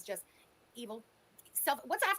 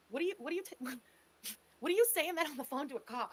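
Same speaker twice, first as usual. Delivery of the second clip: ordinary talk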